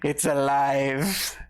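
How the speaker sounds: very slow, hammed-up Frankenstein cry; mad scientist. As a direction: stretch it out like a mad doctor. Bot comes back on, mad scientist.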